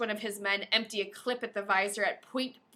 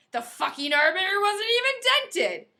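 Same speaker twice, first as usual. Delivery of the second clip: excited exclamation; 9 semitones higher, 7 dB louder than usual. Acted. Reading battle scene excitedly.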